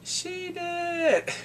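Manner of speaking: very high, drawn-out whine; mock wail pointing out the culprit; tattling thugs. Thugs answering the sergeant.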